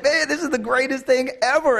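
high-pitched giddy rejoicing; elated. Retold or imitated. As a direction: crow with delight, way up high. Thrilled that Jerry loves it.

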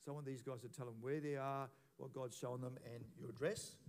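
ordinary talk, nothing marked

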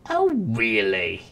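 big slow booming "Oh, really?"; loud. Acted. Imp taunting the queen, loud and slow. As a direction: say it loudly, as if in capitals. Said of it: "that was in capitalized letters, so I gotta say that loudly".

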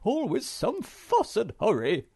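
high, loud grumbling character voice; muttered grumble. A narrator's reading. Hemulen complains over his stamps, grumbling. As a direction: grumble it high-pitched, put out by the fuss. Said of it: "he muttered".

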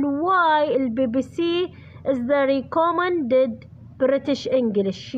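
very slow, word by word, for writing down; slow dictation. Shown as a demonstration. Teacher dictating the answer to her students, slow and deliberate. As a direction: spell it out slowly so students can copy.

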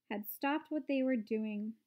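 usual speaking voice

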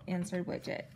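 ordinary delivery, nothing marked

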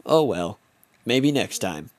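usual speaking voice

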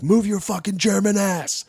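high-pitched imitation of bullying coaches; mock coaches' yelling. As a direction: berate the kid in a high mock yell. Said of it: "and they're like".

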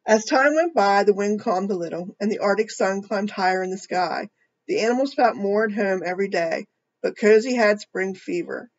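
put-on reading a picture book to children; storybook reading voice; story narration. A narrator's reading. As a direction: tell it gently to a young audience.

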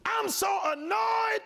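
high, loud, slow exasperated cry; hamming up the annoyed believer; mock annoyed yell. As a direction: wail it like you're fed up. Acting annoyed complaint.

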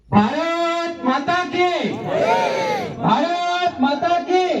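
high, drawn-out rally cry; slogan call. Real, not acted. Rally speaker leads the crowd in slogans, rousing chant call. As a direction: call it out slowly, stretching each word for the crowd.

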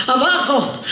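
high put-on granny voice; comic high-pitched granny; old woman voice. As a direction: answer as a mock old lady. Old woman answering the robbers.

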